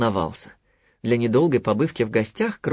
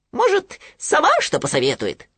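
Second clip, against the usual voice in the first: high squeaky little piglet; 3 dB louder than usual. Acted. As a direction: pitch it way up like a tiny piglet. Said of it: "предположил Хрюня". (писклявый голосок Хрюни)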